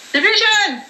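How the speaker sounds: high-pitched shout-out; a sudden high hail to someone; calling out a name. Real, not acted. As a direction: call the name out, pitched well up.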